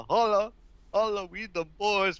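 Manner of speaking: high, loud hyped meme voice; We Them Boys impression. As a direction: belt it like the meme. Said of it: "you should do, like, the We Them Boys".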